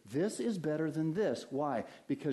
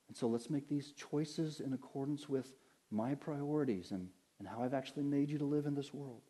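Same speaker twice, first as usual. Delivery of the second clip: softer, patient, hushed God; gentle voice of God; 2 semitones lower, 6 dB quieter than usual. Retold or imitated. God speaking patiently to a frustrated believer, hushed. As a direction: lay the manual gently in their lap. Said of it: "And God says".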